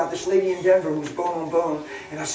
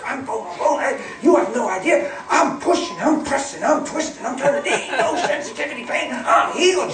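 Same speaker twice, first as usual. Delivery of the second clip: fired up; 5 semitones higher than usual. Retold or imitated. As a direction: imitate a fired-up woman, high and insistent. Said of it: "She's fired up".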